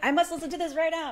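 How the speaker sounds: high, fast, eager burst; eager podcast fan deciding to switch audiobooks, fast and high. Retold or imitated. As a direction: rush it out, dying to hear it. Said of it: "I was like".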